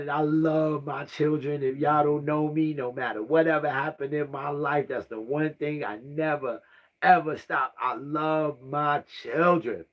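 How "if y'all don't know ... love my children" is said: normal talking voice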